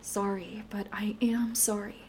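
soft unvoiced whisper; whispered sorry. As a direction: whisper it with full sincerity. Sincere apology to partner.

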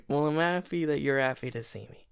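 ordinary talk

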